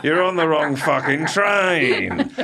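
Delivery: high, slow, laughing it out; laughing relief. Retold or imitated. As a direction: blurt it through laughter of relief. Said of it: "I cried out of sheer shitting relief".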